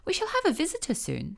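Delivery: gossipy field mouse. A narrator's reading. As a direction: announce the news like a busybody old mouse. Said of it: "said the field mouse one day".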